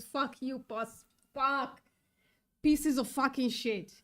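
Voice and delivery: loud, raging curses at the culling; real anger, half shouted; angry outburst. Real, not acted. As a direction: spit the swearing out in real fury. Raging at the chicken cull report.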